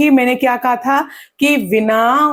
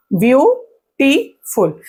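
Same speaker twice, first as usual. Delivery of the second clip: "Beautiful" slowly as view, टी, फुल; three spaced beats, फुल falling; split into syllables; 2 semitones higher, much slower than usual. Shown as a demonstration. Teacher breaking the word for students, beautiful in three parts. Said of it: "ये शब्दों को तोड़ रहा है".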